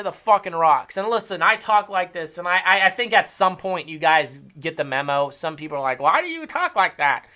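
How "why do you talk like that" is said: higher-pitched imitation of a critic; mock complainer. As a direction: mimic someone whining a complaint. Mimics complaining viewers.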